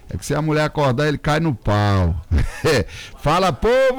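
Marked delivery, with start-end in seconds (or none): 3.2-4.0 high-pitched radio holler; rallying on-air hail; calling out. Real, not acted. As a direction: summon the listeners with a raised call. Host calls out to the listeners.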